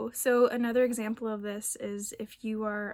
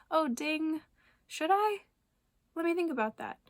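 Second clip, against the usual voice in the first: lifted, bright sing-song self-check; playful self-query; 6 semitones higher than usual. Pretend. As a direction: ask yourself lightly, like a bell just rang. Mock inner voice checking itself.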